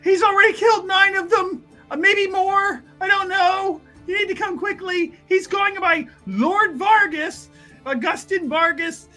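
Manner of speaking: high, frantic, pleading report; panicked alarm. Acted. Student telling guards who the killer is, frantic.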